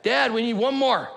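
high, loud call-out to Dad; imitated kid's yell. Son yelling up to dad on the deck, imitated kid's yell. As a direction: call up to the deck like an eager kid. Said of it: "So he yells out to the deck".

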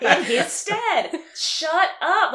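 loud, high mock exasperation; hammed-up irritated outburst; annoyed. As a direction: drag it out, fed up and half laughing. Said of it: "it was, like, an annoying thing".

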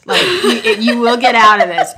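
laughing. Retold or imitated. Quoting lady, laughing.

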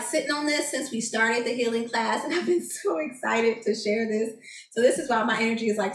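usual speaking voice

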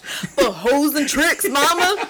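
high, loud, laughing mimicry; sing-out mock swearing through a laugh; imitated schoolgirl. Daughter swearing back to her mother, imitated schoolgirl.

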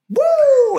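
high, hammed-up woo cry; mock wrestler woo. Retelling a wrestling show, a high mock woo. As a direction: whoop it like a ring showman.

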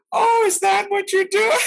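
a tiny-voiced teacher's mocking exclamation; tiny high teacher voice. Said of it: "she had a really little bitty voice".

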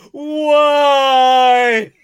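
loud high crying why; anguished wail. Acted. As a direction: wail in despair. Told last episode.

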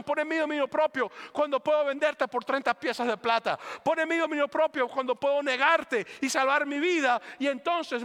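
fiery preaching. Acted. Pastor urging the congregation toward self-control, fiery preaching. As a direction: preach it out to the crowd, building.

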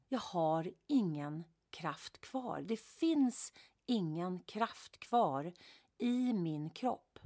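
slow, heavy words with no energy; drained, slow. Retold or imitated. Burnt-out woman recalling collapse on the sofa, slow and drained. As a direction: let each word sag with exhaustion. Describing total exhaustion.

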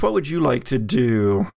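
usual speaking voice

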